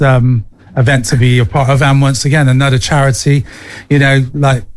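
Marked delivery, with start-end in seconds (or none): none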